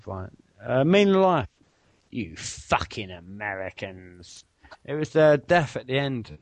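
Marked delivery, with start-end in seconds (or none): 2.1-4.4 slow low film voice; drawn-out quoted line; Monty Python quote. Retold or imitated. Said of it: "name the film".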